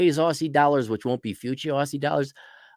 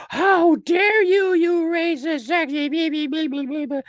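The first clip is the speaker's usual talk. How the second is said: much higher, slow hammed-up indignation trailing into blah; mock outraged accuser; slower than usual. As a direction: squeal a shocked accusation, then fade into babble. Imagined outraged accuser responds.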